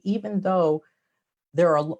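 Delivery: normal talking voice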